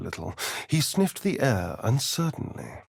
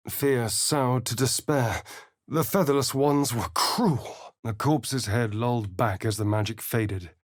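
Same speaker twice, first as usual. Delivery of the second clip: normal talking voice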